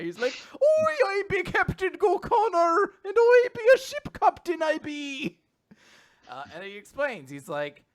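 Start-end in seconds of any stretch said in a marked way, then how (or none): 0.5-5.4 high, loud, slow hammy Irish brogue. Pretend. Lost captain introducing himself in stage Irish. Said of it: "a stereotypical Irish guy".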